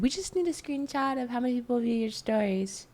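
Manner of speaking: high, put-on corporate voice; brand rep voice. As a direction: mimic a company rep asking. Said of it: "And then they said".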